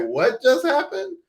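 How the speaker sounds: the whole family caught completely off guard; stunned amazement. Retold or imitated. The family stunned by the father's apology, high and astonished.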